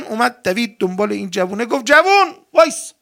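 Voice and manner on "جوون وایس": loud, raised call to halt someone. Acted. Priest running after the young man, calling out to stop him. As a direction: shout after someone walking away.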